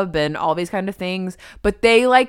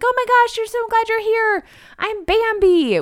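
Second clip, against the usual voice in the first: much higher, bouncy gushing welcome; bubbly greeting. Retold or imitated. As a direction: come bounding in, thrilled to meet them. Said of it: "this woman comes bounding out".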